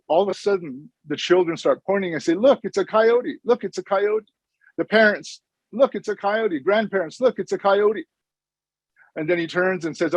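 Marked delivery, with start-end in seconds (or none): 2.3-4.3 lightly voiced excited children, pitched higher; children calling out. Children pointing and calling out in story. 5.7-6.6 lightly acted onlookers, higher pitch; parents calling out. Parents echo the children in story. 7.2-8.1 lightly acted onlookers, higher pitch; grandparents calling out. Grandparents echo the call in story.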